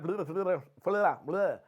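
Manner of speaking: spluttered blah-blah babble; mock flubbing. Presenter flubbing his invite to a prospect, garbled. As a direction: garble it like tripping over your tongue. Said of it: "flubbed all over my face".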